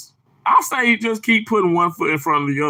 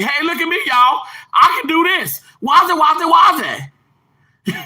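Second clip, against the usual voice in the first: high, loud show-off outburst; mock attention-seeking; 11 semitones higher, 4 dB louder than usual. Streamer joking about what not to say while intubating, mock show-off. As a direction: holler for everyone to watch, hammed up. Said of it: "I'm not gonna say".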